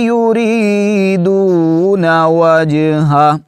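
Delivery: slow high melodic tilawat; Quran recitation. Acted. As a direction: recite slowly with long drawn vowels.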